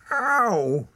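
high, loud yelp of pain. A narrator's reading. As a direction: yelp as if suddenly hurt. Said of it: "she yelped".

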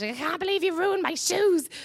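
high, whiny mock outrage; hammed-up sister wailing over her ruined shoes; mock outraged sister. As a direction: imitate the sister throwing a fit. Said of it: "She went mad".

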